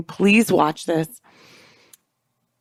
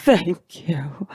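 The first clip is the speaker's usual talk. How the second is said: breath-only imitation of humble thanks; hammed-up whispered gratitude; 2 semitones lower than usual. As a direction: whisper it like a martyr bowing. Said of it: "She whispers".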